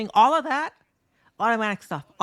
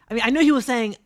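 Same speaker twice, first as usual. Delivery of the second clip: ordinary talk, nothing marked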